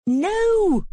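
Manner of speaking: sharp high shouted No; shouting no. Acted. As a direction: cut him off with a shout. Mom refuses tantrum demand.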